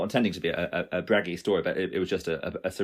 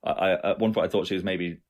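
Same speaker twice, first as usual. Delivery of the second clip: ordinary delivery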